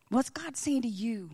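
ordinary talk

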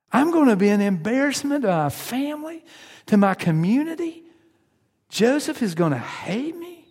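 Mary pleading with Gabriel, hammed-up dismay; imitating upset Mary. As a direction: protest to the angel that your life is ruined. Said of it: "Mary could have said to Gabriel".

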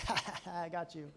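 low, soft gloating laugh; mocking chuckle. Dad who gave his son a snake, gloating chuckle. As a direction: chuckle at your own mean prank.

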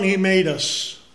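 normal talking voice

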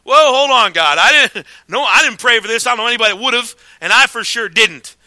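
loud, startled protest; mock-alarmed denial to God. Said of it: "I said".

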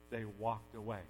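ordinary talk

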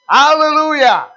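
loud, high, ringing proclamation; saints' hallelujah. Acted. Preacher voicing the praising saints of God, exultant shout. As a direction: cry it out long and high, like a congregation praising. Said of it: "saints of God gonna say".

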